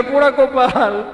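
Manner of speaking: drawn-out mock lament of a father; slow, hammed-up dismay; exasperated lament. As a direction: groan at the children's blunder, acted. Father despairs at sons' misreading.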